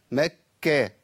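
"مكة" with the kaf held shut, then released; doubled kaf. Shown as a demonstration. Teacher isolating the second shadda, doubled kaf. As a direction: pause on the kaf closure in "مكة". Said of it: "وعندي كاف مشددة".

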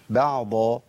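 "بعض" with a clear ayn and firmly seated Dad. Shown as a demonstration. Teacher modelling the articulation point, firm Dad. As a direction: separate the ayn from a full, heavy Dad in "بعض". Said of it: "والضاد لابد من تمكين المخرج".

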